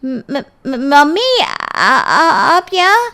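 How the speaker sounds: high, frightened stuttering daughter; squeaky-high, stretched-out stutter of panic; panicked stammer. Acted. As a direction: stammer in shock, caught red-handed. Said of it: "सिट्टी पिट्टी गुम हो जाती है".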